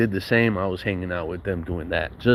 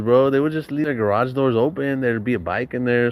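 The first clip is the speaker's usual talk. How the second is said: ordinary delivery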